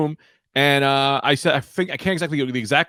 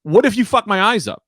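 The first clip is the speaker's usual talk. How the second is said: mad; 8 semitones higher than usual. Retold or imitated. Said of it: "I immediately got mad".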